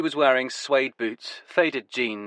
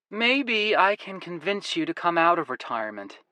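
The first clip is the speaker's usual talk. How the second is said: coaxing American woman; 8 semitones higher than usual. Acted. Woman character voice.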